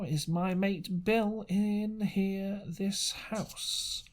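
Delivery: slow, scheming sailor's drawl; crafty old seaman, probing and slow; sly seafaring stranger. Acted. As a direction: fish for information with a sly pirate voice. Stranger's long speech in story.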